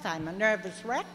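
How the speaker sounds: ordinary talk, nothing marked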